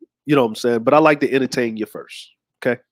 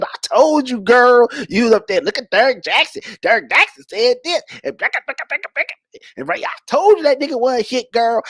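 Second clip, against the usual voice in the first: put-on high-pitched sassy girlfriend voice; gossiping girlfriend, high; 7 semitones higher than usual. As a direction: dish it to your girl, high and sassy. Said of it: "There's women that said".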